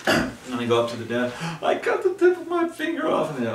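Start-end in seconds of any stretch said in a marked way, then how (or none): 1.6-3.4 very high, loud wail; exaggerated hysterical complaint; mock panic. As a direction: squeal it in hammed-up alarm. Panicked plea at clinic desk.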